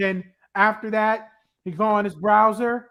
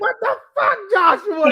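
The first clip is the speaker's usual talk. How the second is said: shrieking mom; 10 semitones higher, 3 dB louder than usual. Retold or imitated. Mother's voice retold.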